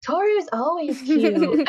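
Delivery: put-on boy praising Toru while floating away, sweetly. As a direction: draw it out like a doting little boy. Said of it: "Momiji was like".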